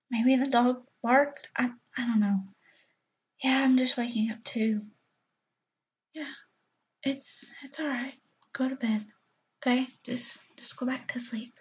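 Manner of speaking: soft sleepy whisper; pillow talk. Acted. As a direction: hush him back to sleep. Soothing half-asleep partner at night.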